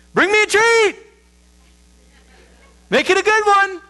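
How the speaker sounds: put-on high, loud child calling out a demand; Samuel's kid voice. A son shouts after his father leaving to hunt, high and eager. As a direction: pipe up like an excited little boy shouting after dad. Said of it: "Samuel says to me".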